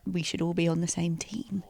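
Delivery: soft sincere whisper. Real, not acted. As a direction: breathe it out, earnest and hushed.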